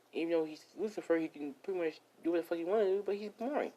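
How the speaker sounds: high-pitched little creature griping; high, squeaky character voice, grumbling away; complaining gingerbread man. Acted. Gingerbread man complaining about boredom down here, high-pitched griping.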